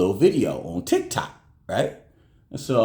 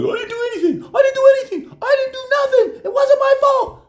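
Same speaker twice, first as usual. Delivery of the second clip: put-on very high, louder whiny kid voice; panicked nephew; 4 dB louder than usual. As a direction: plead innocence over and over like a scared boy. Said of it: "And then my nephew's like".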